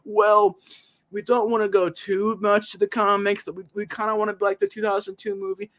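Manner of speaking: high, wishy-washy mock voice; mocking waffling. Fan imitating indecisive filmmakers, mocking waffle. Mimicking the filmmakers' wavering excuse.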